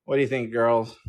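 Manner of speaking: usual speaking voice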